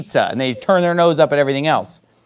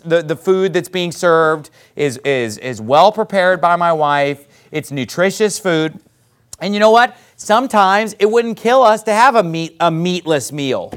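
normal talking voice

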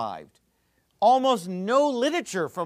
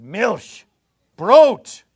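German words, Brot punched out loud; German pronunciation; 2 semitones higher, 12 dB louder than usual. Shown as a demonstration. Teacher lists easy German basic words, German pronunciation. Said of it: "Those all sound like German".

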